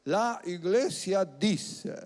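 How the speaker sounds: slow, drawn-out voice of an institution; a measured mock-official pronouncement; institutional voice. Pastor imitating the institutional church, in a slow official voice. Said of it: "no la voz de la institución".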